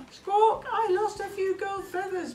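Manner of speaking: high squawky bird voice; parrot voice. Acted. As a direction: talk like a cheerful parrot. Parrot kite speaks.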